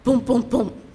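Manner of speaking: loud mimicked door banging; imitated pounding. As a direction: punch each boom like a knock on a door.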